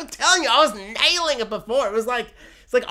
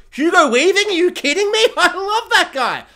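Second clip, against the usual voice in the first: loud, high fanboy outburst; hammed-up delighted disbelief; gushing excitement; 10 semitones higher, 6 dB louder than usual. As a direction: get carried away, pitch shooting up. Bear gushing in comic retelling.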